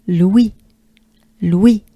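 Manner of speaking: "Louis" with the O swallowed, focused on the E; French Louis. Shown as a demonstration. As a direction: glide fast through "Louis" to the E. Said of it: "focused on the E".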